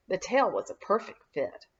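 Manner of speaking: plain reading voice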